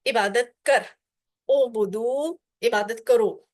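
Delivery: ordinary talk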